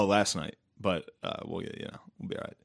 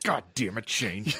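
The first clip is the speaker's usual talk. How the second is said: lightly voiced exasperated father; a hint of a scolding dad; imitated angry dad; 4 dB quieter, slower than usual. Dad gripping his son's neck, mild mock scolding. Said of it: "my dad be like".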